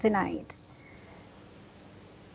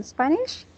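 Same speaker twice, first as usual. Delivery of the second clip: Spanish rising higher on its last syllable; rising question tone; 4 semitones higher, 5 dB quieter than usual. Shown as a demonstration. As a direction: ask it, pitch climbing on Spanish. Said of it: "I'm asking you a question".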